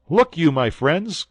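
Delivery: loud, slow urgent cry; alarmed cry to the friends. A narrator's reading. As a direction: call out to his friends in alarm. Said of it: "cried D'Artagnan".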